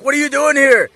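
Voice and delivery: high, loud startled demand; hammed-up astonishment at seeing someone; all surprised. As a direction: blurt it as a mock-shocked shout. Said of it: "you know, all surprised".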